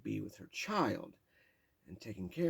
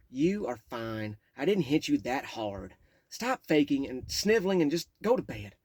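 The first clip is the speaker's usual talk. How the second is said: cold dismissive mother; 4 semitones higher, 10 dB louder than usual. Retold or imitated. Mother dismissing the boy's panic: cold loud brush-off. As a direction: brush him off coldly. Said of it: "Mom stood there watching".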